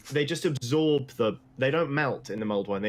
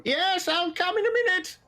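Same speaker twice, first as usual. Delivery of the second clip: much higher voice calling back from far off; distant woman's reply. Acted. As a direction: answer from the back room, high. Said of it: "you hear a voice from the back".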